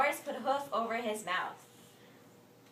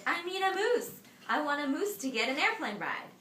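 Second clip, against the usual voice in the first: insistent; 6 semitones higher, 5 dB louder than usual. Acted. Morris insisting on his riddle.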